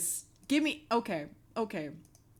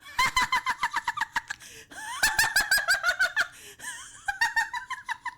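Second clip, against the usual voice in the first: long shrieking laugh, much higher and louder; hysterical high laugh; 4 dB louder than usual. Real, not acted. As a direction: lose it completely, cackling in disbelief. Laughing hard at new model.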